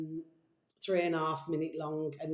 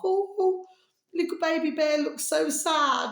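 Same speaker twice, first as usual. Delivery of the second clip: much higher, louder aww over the cub; sympathetic cooing; 10 semitones higher, 5 dB louder than usual. Real, not acted. Vlogger pitying the cub that lost its balloon, high sympathetic coo. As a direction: coo with pity for the little bear.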